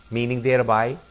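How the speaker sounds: usual speaking voice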